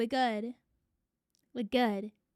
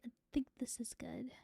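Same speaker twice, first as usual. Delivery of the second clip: hushed, breathy whisper; 12 dB quieter than usual. Real, not acted. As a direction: whisper it to yourself. Checking if stream is stable.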